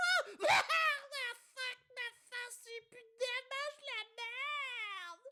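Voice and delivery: high yelping crash voice; squeaky pained crash. Pretend. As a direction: squeal through the wipeout. Acted racer crashes face first.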